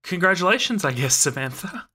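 high, loud and uncertain; confused Jacques. Acted. Jacques wrongly congratulates Samantha, high and unsure. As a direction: congratulate her, not quite sure why.

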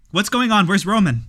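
high, loud alarmed questions. Acted. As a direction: jump up worried. Alan alarmed.

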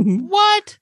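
high, loud incredulous "what"; mock shock. Co-host retelling the husband's reaction to the news, mock shock. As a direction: yelp it in put-on disbelief. Acting out Herman's shocked reply.

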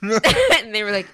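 ordinary delivery

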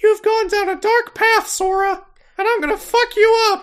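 squeaky cartoon mouse making a threat; Mickey Mouse voice. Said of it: "that's just Mickey Mouse now".

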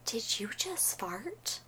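silent mouthed question, barely voiced; mouthed whisper. Mouthing across the counter to her boyfriend, whispered. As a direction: mouth it so only he can catch it. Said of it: "I mouthed to him".

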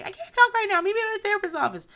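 squeaky hasty dismissal; hurried Tori. Retold or imitated. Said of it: "she's like".

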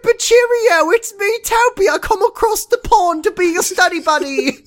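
put-on very high, silly British accent; hammed-up fake British greeting; squeaky cartoon Brit; Toby. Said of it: "fake British accent".

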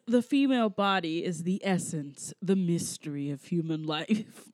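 low, hushed, slowing to a reverent finish; mock seductive suitor. Host quotes Italian suitor's speech about women, mock seductive.

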